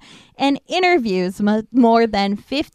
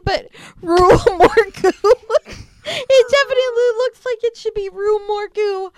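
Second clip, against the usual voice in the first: high, tearful laughing protest; high voice breaking up with laughter; laughing to tears; 9 semitones higher than usual. Real, not acted. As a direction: plead your case through helpless laughter. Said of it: "there are tears going down my cheeks".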